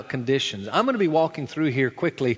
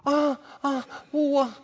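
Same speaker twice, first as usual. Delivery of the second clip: put-on bewildered little boy; 11 semitones higher, slower than usual. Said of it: "Looks up at you".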